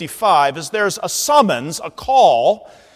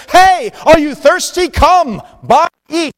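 high, loud market vendor cry; hawking vendor; 9 semitones higher, 6 dB louder than usual. Acted. Vendor in the marketplace calling to buyers, hawking loudly. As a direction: hawk your wares to passersby. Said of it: "the vendors are out there hawking their wares".

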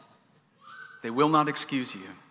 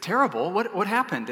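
higher, louder startled echo of Terrible; surprised question; 7 semitones higher, 4 dB louder than usual. Retold or imitated. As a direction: caught off guard, ask what happened. Pastor surprised at friend's answer.

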